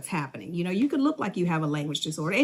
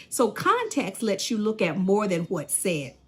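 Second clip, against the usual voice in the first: ordinary talk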